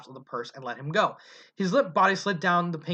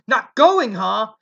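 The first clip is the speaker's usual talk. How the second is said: much louder and higher, full yell; 5 semitones higher, 10 dB louder, much slower than usual. A narrator's reading. Mother yelling back at her stubborn son, loud. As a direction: throw it back at him as a yell. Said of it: "Ledway yelled".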